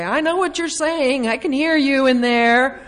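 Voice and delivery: mock-accusing call through the house. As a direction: call out to someone in the kitchen. Calling to murmuring family member.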